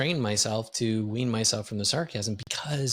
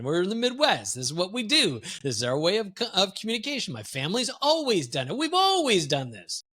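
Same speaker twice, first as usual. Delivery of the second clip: making light of it; 2 semitones higher than usual. Retold or imitated. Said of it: "I was trying to make light of it".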